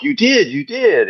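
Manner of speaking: put-on high, drawn-out chorus of insisting kids. Kids tell their friend he said it, mock insisting. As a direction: imitate kids piling on, slow and high.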